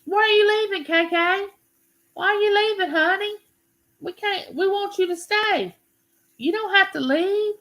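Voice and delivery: high, coaxing plea; mock-pleading sing-song, way up high; playful pleading. Real, not acted. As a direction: beg her sweetly not to go. Pleading with a leaving viewer.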